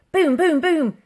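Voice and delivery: booming knock sounds, loud and high; three heavy knocks. A narrator's reading. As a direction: pound out each boom like a fist on a door.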